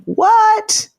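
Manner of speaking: high squeak of disbelief; incredulous what. Retold or imitated. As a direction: pitch it way up in shock. Listener's incredulous question read out.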